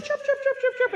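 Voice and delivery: put-on high chirps like baby chicks; imitating chicks outside the door; chirping. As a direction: peep it out like little chicks.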